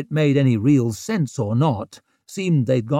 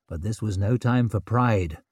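ordinary delivery

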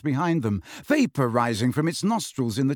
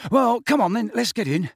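put-on brisk, high-pitched boyish urging; brusque change of subject; gruff; 8 semitones higher, 3 dB louder than usual. A narrator's reading. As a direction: hurry them along impatiently. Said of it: "said Ron gruffly".

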